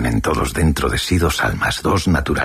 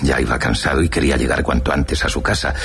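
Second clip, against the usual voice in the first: usual speaking voice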